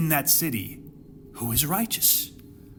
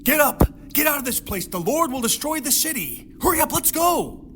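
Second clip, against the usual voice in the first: urgent shout; 10 semitones higher than usual. Retold or imitated. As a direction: yell down at them to hurry. Lot shouting at blinded sons-in-law.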